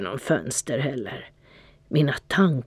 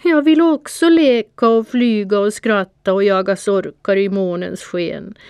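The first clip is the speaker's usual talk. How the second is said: higher and louder, a pitiful mewling plea; 5 semitones higher, 4 dB louder than usual. A narrator's reading. The tearful cat pleading with Panter, pitiful mewling. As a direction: whine it like a tearful kitten. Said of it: "jamade jag ynkligt".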